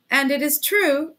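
a gleeful, quivering fury; furious delight. A narrator's reading. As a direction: relish insulting him, voice shaking with fury. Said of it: "a voice quivering with fury and delight".

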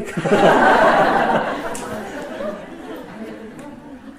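a long, breathy laugh that rises high; amused laugh rising in pitch. Real, not acted. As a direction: let the laugh run on and climb. Laughs on at the question.

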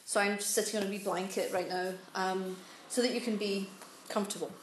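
ordinary talk, nothing marked

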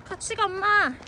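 calling out. Real, not acted. Calling to mom hiking ahead.

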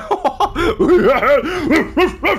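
loud, high-pitched burst of laughter; high laughing shriek. Real, not acted. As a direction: crack up in a high squeal. Laughs at a lucky play. (éclat de rire aigu)

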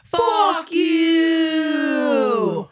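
long loud shouted curse. Real, not acted. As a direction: bellow it out. Shouted fuck you segment.

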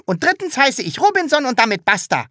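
high, loud defiant declaration; stubborn insisting. A narrator's reading. Sams defiantly insists on its new name, loud and high. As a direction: put your foot down.

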